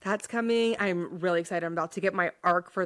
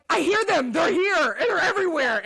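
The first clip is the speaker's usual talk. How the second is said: higher, louder frantic cries; mock frantic character; 9 semitones higher, 5 dB louder than usual. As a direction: act out the panicked hero. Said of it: "it's like".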